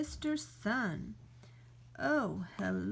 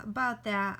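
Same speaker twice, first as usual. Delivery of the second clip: normal talking voice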